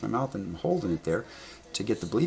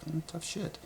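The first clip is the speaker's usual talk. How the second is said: higher and much softer, offhand dismissal; dismissive shrug; 8 semitones higher, 10 dB quieter than usual. Retold or imitated. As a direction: brush it off with a sneer. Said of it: "And I'm like".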